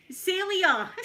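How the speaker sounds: high, loud holler to a colleague; calling out. Acted. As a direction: shout the name across the shop. Checkout operator calls out to colleague.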